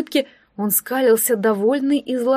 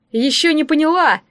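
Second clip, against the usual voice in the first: gloating villain, higher and louder; mustache twirling villain; 5 semitones higher, 4 dB louder than usual. A narrator's reading. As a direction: taunt her with sinister glee. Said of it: "зловеще засмеялся пиромант".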